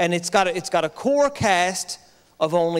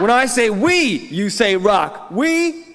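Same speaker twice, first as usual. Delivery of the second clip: crowd call-and-response shout; 4 dB louder, slower than usual. Real, not acted. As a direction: pump the room up, shouting the cue.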